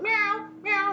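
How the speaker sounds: high mock meowing cat; cat meow imitation. Imitating her cat staring up at the ceiling, mock meowing. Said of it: "just going".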